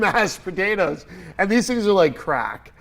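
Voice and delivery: ordinary talk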